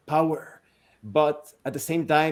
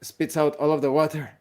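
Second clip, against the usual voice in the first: ordinary talk, nothing marked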